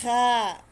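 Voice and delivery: "Ha" as a long, loud, throat-scraped KHA; gargled KHA. Shown as a demonstration. Teacher models the letter after explaining it, gargled KHA. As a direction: gargle the start of "Ha", then hold the vowel. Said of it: "para kang nagagargle".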